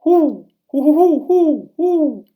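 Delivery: high loud hooting owl call, drawn out. Acted. Pa calling out to the owl in the woods, loud high hoots. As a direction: hoot like a great horned owl. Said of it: "And then Pa called".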